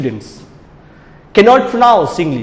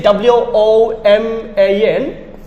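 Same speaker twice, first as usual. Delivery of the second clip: slow, letter by letter; spelling out; much slower than usual. Shown as a demonstration. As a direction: spell it out slowly, one letter at a time. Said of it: "if I say".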